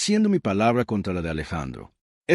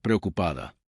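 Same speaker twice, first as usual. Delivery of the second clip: ordinary delivery, nothing marked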